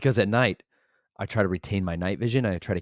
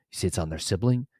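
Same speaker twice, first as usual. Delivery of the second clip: normal talking voice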